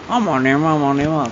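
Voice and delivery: nema said with a lisp for "nama" in a mocking tone; teacher imitates a lisping speaker saying nema; imitating a lisp. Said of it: "ngomongnya cadel, ikutin cadel".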